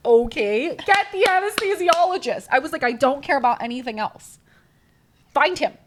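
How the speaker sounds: loud, high, urgent demand; desperate demand. Retold or imitated. Woman in labor demanding the anesthesiologist, loud and high. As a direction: yell for the anesthesiologist.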